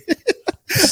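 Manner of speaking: a quick high-pitched giggle. Real, not acted. Guest laughing at his own joke, high quick giggle. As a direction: laugh it off lightly. Guest joking about being nervous.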